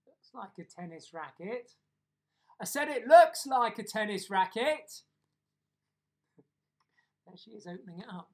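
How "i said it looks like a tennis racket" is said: very loud, raised-pitch repetition; booming, insistent "I said"; loud repeat. Acted. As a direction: shout it for someone hard of hearing. Repeats it for deaf Granny, loudly.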